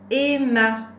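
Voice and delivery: loud, high, deliberate model word; word dictation. Shown as a demonstration. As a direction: call out each syllable clearly for a learner. Teacher dictates word for syllable listening.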